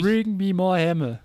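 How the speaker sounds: mock Thor. Imitating Thor.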